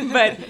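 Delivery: a bright burst of laughter; real laugh. Real, not acted. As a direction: laugh it off, then go on. Laughs at own story.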